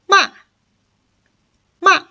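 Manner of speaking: short "ma" falling steeply from high; fourth, falling tone. Shown as a demonstration. Teacher links four tones together, ending on falling "ma". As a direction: drop "ma" quickly from the top. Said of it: "these four tones linked together".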